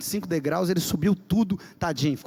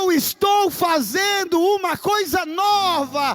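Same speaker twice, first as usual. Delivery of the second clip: high, loud and slow, near tears; impassioned cry; 12 semitones higher, 5 dB louder, much slower than usual. Real, not acted. Preacher repeats the verse to the church, crying out high and loud. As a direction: cry the verse out with full force.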